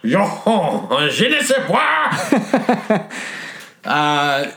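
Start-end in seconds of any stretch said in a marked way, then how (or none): 0.0-3.1 high, slow, laughing French; mock French accent. Friends clowning in French, slow laughing mock French accent. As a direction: drag out the French through laughter.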